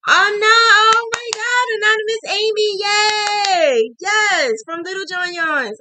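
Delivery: high, loud sing-song squeal; squealing with joy. Real, not acted. Thrilled by a shout-out from Little John Yarns, squealing. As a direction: shriek with delight at the surprise. Squealing at a viewer's gift/comment.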